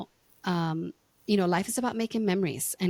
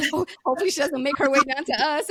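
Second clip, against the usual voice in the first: fast, louder and higher through laughter; laughing talk; 7 semitones higher, 6 dB louder, faster than usual. Real, not acted. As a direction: rush the words while still laughing. Retelling while laughing.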